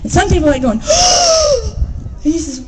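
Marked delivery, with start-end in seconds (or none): 0.9-2.2 mock gasp. A narrator's reading. Crowd gasps at bare tail.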